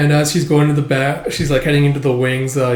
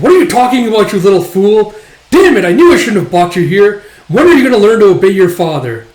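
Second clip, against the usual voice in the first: loud, higher-pitched angry telling-off; irate dad, voice raised hard; scolding father; 6 semitones higher, 8 dB louder than usual. Acted. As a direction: scold your child in exasperation. Father scolds child in acted story.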